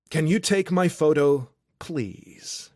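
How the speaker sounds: courteous, with a softened "please"; polite request. A narrator's reading. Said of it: "Cole asks politely".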